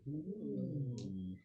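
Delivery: ordinary talk, nothing marked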